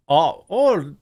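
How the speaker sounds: high, hammed-up Indian accent; mock Indian accent. Host mocking Harris in an Indian part of town, mock Indian accent. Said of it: "she goes to a deep Indian center of town".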